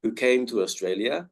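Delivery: usual speaking voice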